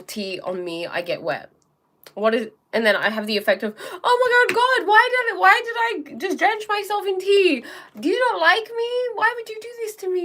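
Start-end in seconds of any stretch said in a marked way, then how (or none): 4.0-10.2 high, loud over-dramatic wail; hammed-up despairing victim, much higher. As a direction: whine to the heavens like you're the victim. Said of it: "I could be a victim and say that".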